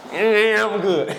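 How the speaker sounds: high, effortful mock strain; imitated straining effort. Man getting back into his wheelchair, imitated straining voice. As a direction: say it as if hauling yourself up.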